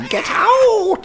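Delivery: shrieking high-pitched gull cry; delirious scream. Acted. Hazen screaming at his brother Thorpe to leave. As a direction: screech it out in crazed fury. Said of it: "screamed Hazen deliriously".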